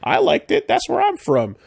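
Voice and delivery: very high silly Emperor voice; high mocking Emperor. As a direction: squeak it like a cartoon Emperor. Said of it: "Emperor's like".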